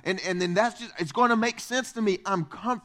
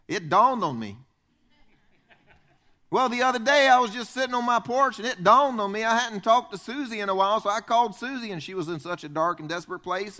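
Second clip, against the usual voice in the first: put-on Southern accent. Preacher imitates Southern churchgoers telling a story in a Southern accent. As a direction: lean into a folksy Southern story. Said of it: "We're Southern down here".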